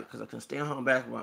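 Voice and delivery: ordinary talk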